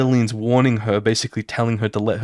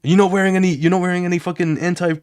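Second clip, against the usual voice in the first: heated accusation; 7 semitones higher than usual. Retold or imitated. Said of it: "And then he's like".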